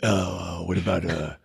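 low, halting guy voice; Pete. Acted. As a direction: drop low and stumble through the ums. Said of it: "Pete, he's like, he's like".

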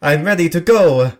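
high, loud eager entrance; Giuseppe. Acted. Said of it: "that's when Giuseppe shows up".

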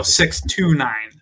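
"six to nine" slow and loud, word by word; "six to nine" spelled out slowly to correct a mishearing; slow, separated correction. Shown as a demonstration. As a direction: stretch out "six to nine" as three separate words. Correcting misheard number, separating the words.

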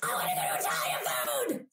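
high, shouted demand for Italian food; a sudden high shout at a coworker about lunch; explosive shout. Retold or imitated. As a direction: blurt it out like an explosion.

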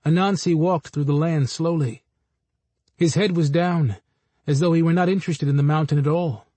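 ordinary delivery, nothing marked

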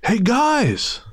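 high, put-on hail from afar; stranger calling out. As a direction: imitate an odd guy hailing acquaintances. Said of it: "we hear a male voice go".

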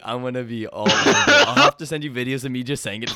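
laughing through the words; giggling excitedly. Real, not acted. Laughing while promising.

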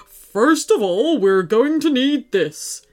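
slow, deliberate put-on teddy voice; teddy taking charge. A narrator's reading. Teddy Marshall instructs the children, taking charge. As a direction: set out the plan in character.

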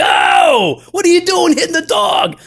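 high loud shout at a stranger; outraged shout at the dog owner; retold yelling. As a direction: yell at him to stop hitting the dog. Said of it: "I'm like".

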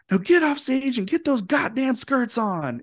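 high, strained angry yell; yelled order. Retold or imitated. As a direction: bark the order at the girls in a rage. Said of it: "just yells it to the girls".

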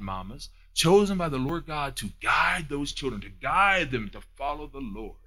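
normal talking voice

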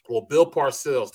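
normal talking voice

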